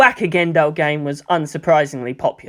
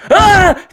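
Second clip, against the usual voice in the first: much higher, louder cry of alarm; startled yell; 14 semitones higher, 9 dB louder than usual. A narrator's reading. Toji yelping as the kids dogpile him. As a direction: yelp as the kids pile on. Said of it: "Toji yelled".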